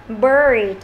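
"bird" with a long curled-tongue R; model pronunciation. Shown as a demonstration. Teacher showing the class the R sound, slow careful "bird". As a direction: draw out "bird", tongue curled, lips slightly rounded. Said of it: "Chúng ta đọc này".

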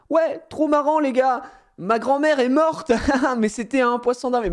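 high, laughing parody of a tasteless prankster; squeaky gleeful mockery; mock idiot joker. Parodying a prankster joking about grandma's death, high gleeful.

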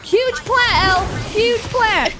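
excited shout; excited cheer. Real, not acted. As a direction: cheer it out loud. Celebrating teammate's ace.

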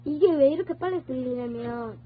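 normal talking voice

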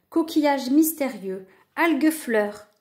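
usual speaking voice

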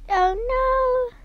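usual speaking voice

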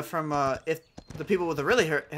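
ordinary talk, nothing marked

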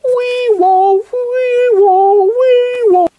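high wee-wah siren; siren noise. Acted. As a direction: wail like an ambulance. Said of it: "I'm gonna do my emergency noise".

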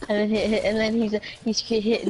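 ordinary delivery

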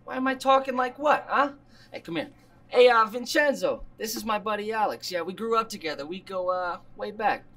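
chummy put-on Italian-American accent; phony Italian-American goodfella talk; fake Italian accent. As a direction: play the Italian restaurateur, accent and all. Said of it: "Not stupid fake accent".

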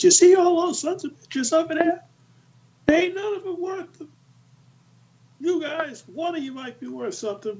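high, sneering sergeant voice mocking the few recruits; mocking drill sergeant. Master gunnery sergeant sneering at the new recruits, mocking. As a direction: sneer it out like a gruff old sergeant.

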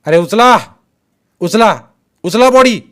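loud, high-pitched order to the men; ringing shouted command. A narrator's reading. Gang man orders his men to lift the body, shouting. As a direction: bark it out so it rings over a crowd. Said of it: "पुन्हा तो आवाज घुमला".

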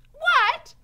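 high, very loud "what?"; Mig's shout. A narrator's reading. Mig to the others, not hearing, high shout. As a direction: shout it as if you heard nothing. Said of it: "shouted Mig".

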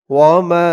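"ওয়া মা" chanted out long on the last vowel; three-alif madd. Shown as a demonstration. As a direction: stretch the "মা" of "ওয়া মা". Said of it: "তিন আলিফ টান হবে".

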